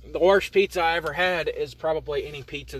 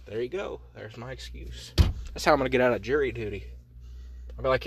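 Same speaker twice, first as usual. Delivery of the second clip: ordinary talk, nothing marked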